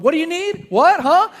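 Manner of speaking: very high, loud imitation of his wife; loud mock questioning. Wife follows a secretive scalper demanding answers, loud and high.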